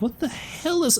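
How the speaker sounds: confused exclamation. Retold or imitated. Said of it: "And they were like".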